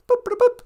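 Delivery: high, chirpy mouth-made boops; computer beeps. Pretend. Playing holodeck, imitating computer beeps. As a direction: beep like a sci-fi computer.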